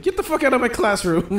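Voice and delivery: high, loud mock outrage in a Caribbean accent. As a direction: imitate the Caribbean teacher throwing someone out. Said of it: "was that a good Caribbean accent".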